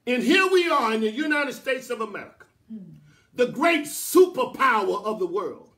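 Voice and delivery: loud, rising sermon cadence; impassioned preaching. Real, not acted. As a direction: preach it with mounting, punchy emphasis.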